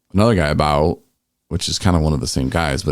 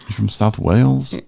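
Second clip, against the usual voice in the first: put-on British accent; 5 semitones higher than usual. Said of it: "do a British accent".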